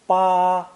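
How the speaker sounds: "Ba" drawn out high and perfectly level; high flat tone. Shown as a demonstration. Teacher running through four tones, high flat "Ba". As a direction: hold "Ba" on one high, loud note. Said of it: "spoken with a high flat tone".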